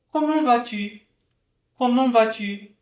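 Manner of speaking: "Comment vas-tu" high and careful; model French. Shown as a demonstration. Teacher modelling the practice sentence. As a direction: say "Comment vas-tu" clearly for the class. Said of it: "Vamos ver se você pronunciou assim".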